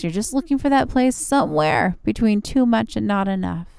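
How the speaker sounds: calm and soothing, easing into rest. Acted. Yoga relaxation cool-down.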